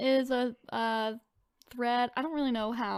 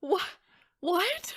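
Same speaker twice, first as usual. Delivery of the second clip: shocked disbelief; 3 semitones higher, 8 dB louder than usual. Real, not acted. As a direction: blurt out in shock. Shocked reaction to post.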